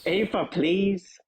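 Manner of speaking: Belfast lilt, lines rising like questions; a light Belfast IRA man, selling Mandarin lessons; Northern Irish accent. Acted. IRA man urging his cell toward Mandarin, Belfast accent. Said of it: "How's my North Irish accent".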